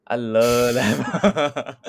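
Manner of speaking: normal talking voice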